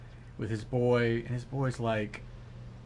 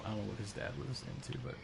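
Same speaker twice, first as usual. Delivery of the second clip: usual speaking voice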